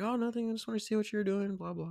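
airy, casual sister impression; imitating his sister. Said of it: "And she's like".

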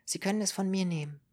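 high, quick earnest offer; young woman's voice. Acted. Ruth offering her own blood, high and quick. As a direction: offer it eagerly in a lifted voice.

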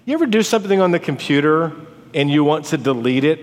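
normal talking voice

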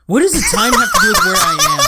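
high, laughing disbelief. Real, not acted. Incredulous laughing question.

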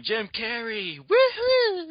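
put-on high, excited kid cheer; kid yelling. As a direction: whoop it out like a hyper kid.

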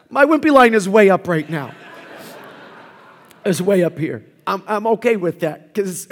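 normal talking voice

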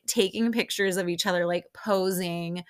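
ordinary talk

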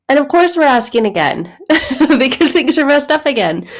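a real burst of amused laughter; laughing. Real, not acted. Preacher laughing at how we keep asking again.

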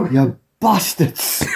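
high, slow parody curse; drawn-out comic swearing, laughing. Acted. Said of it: "sound like [name], [name]".

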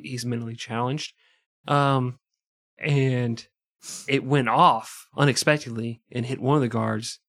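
ordinary talk

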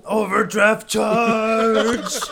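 high, very slow hammed-up lament; mock wail. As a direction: drag it out like a cartoon miser. Mocking friend's stereotype voice.

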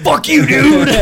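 high, loud, comic outrage; mock-angry shout. Retorting to Jose who fooled him, mock-angry shout. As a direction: yell it in playful exasperation.

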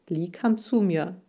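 ordinary delivery, nothing marked

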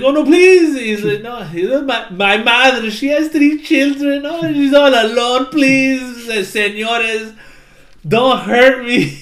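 hammed-up cat begging for his life, slowing at the end; begging Latin-accented cat. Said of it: "I kept trying to do a Latin accent".

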